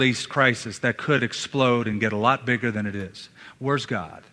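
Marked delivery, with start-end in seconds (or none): none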